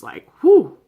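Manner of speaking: sharp loud high exhale of relief; big relieved whoosh; whew. Retold or imitated. Reacting to a suspenseful episode.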